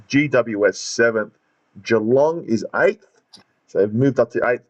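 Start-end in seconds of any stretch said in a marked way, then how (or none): none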